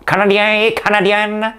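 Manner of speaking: very high, exaggerated English accent in French; hammed-up anglophone French, held on one high note; mock English accent. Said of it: "tu as comme un accent anglais qui arrive".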